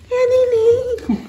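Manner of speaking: high, slow coo with a laugh. Real, not acted. Mother cooing at the newborn, laughing high.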